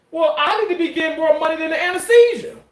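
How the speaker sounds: joking boast. Retold or imitated. Joking retort to doctor.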